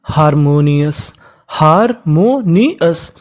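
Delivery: Harmonious slowly split into four parts; teacher slowly breaking up harmonious; syllable by syllable. Shown as a demonstration. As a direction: sound out harmonious, pausing between each part. Teacher sounding out a word.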